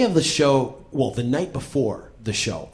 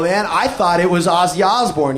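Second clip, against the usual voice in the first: ordinary talk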